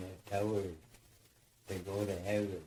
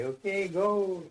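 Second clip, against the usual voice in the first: slow, high, loud call to the crew; drawn-out call across the work line; called-out signal; 10 semitones higher, 8 dB louder, slower than usual. Retold or imitated. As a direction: sing out the go signal to the team. Crew signalling a log push.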